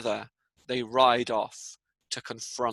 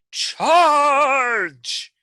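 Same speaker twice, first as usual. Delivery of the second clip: huge high shout; battle cry; 13 semitones higher, 11 dB louder than usual. Acted. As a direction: yell it to lead the charge. Beowulf leads men into cave.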